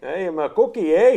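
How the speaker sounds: put-on light Canadian accent, tag it with eh; mock Canadian accent. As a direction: ham up the Canadian host. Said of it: "keep this accent".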